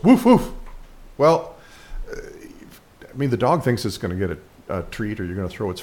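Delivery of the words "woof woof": high, loud mock woof; imitated dog bark. Narrator imitating the dog's bark, high and loud. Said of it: "And the dog goes".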